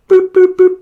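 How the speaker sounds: high, loud imitated boops; phone beeps. Faking the phone connection failing, high loud boops. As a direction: boop like a dropped call. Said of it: "It's a bit of a bad signal".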